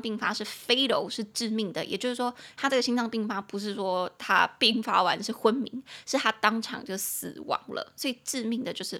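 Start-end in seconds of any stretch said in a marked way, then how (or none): none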